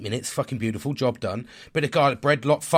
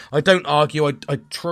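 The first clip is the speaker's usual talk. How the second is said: ordinary talk, nothing marked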